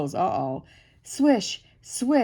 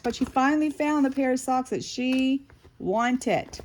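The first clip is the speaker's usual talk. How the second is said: plain reading voice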